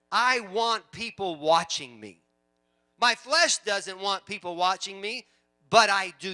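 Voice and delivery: loud, deliberate, emphatic declaration; preaching at full force. Real, not acted. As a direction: declare it slowly and forcefully to the congregation.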